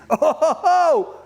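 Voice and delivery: high, laughing exclamation of delight; elated disbelief. Acted. Fisherman Peter seeing the huge catch, laughing elation. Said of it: "first thing he's thinking is".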